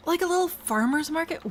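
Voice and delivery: gasping with a sudden high idea; light up with delight mid-sentence; excited gasp. Real, not acted. Sudden idea while building.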